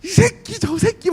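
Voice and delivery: mock rough friend swearing; swearing old buddy. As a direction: fling the insults affectionately. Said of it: "막 이러는데".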